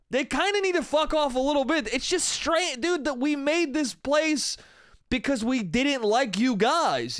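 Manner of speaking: ordinary delivery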